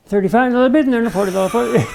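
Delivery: rapid-fire auctioneer chant; rattled off. Retold or imitated. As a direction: rattle it off at top speed. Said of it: "they rattled it right off".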